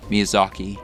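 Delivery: ordinary delivery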